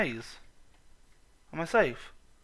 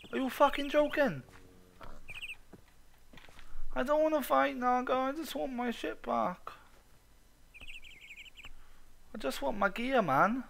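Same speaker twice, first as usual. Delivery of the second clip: exasperated whine; 9 semitones higher than usual. Real, not acted. Boss health bar refilled.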